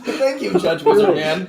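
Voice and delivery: laughing through the words; giggling thanks. Real, not acted. Laughing at wizard hat joke.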